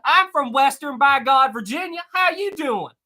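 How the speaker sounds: high-pitched, hammed-up West Virginia twang; exaggerated Southern accent. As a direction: proudly overdo the Southern accent as a joke. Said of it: "I do that just to mess with people".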